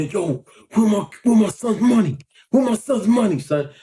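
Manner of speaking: imitating pops demanding his son's money; angry father confronting. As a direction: bark it like a furious dad. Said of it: "my pops went over there".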